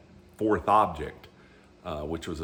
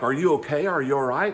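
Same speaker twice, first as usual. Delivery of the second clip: higher and louder, voicing her concern; worried ex-wife; 7 semitones higher, 4 dB louder than usual. Ex-wife asking the laughing man in the truck, higher concerned voice. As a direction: play the ex-wife rushing up, alarmed.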